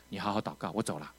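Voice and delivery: low, soft instruction to someone praying; hushed, low goodbye; soft parting words. Retold or imitated. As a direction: imitate a preacher quietly taking his leave. Voices the evangelist leaving him praying.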